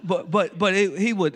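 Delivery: ordinary delivery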